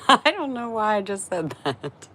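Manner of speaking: giggling through the words; laughing at her own odd phrase. Real, not acted. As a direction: laugh it off, embarrassed. Laughing at herself.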